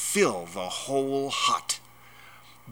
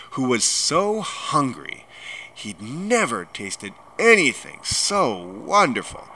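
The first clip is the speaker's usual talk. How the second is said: usual speaking voice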